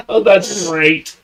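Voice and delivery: high squeaky laugh into words. Real, not acted. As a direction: laugh through it. Laughing at hiccups.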